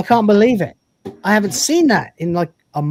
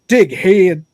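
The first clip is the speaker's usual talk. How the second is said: louder "Dickhead" in another accent, second vowel stretched to "hee-ud"; different accent; 2 semitones higher, 6 dB louder than usual. Shown as a demonstration. Streamer joking how other accents say it, exaggerated different accent. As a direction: put on a non-British accent for "Dickhead", drawn into "heed". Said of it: "different accents gonna be like".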